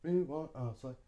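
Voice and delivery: softly mumbled word-by-word; a low-volume echo of the careful student; learner's unlinked speech. Shown as a demonstration. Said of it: "Takes a long time".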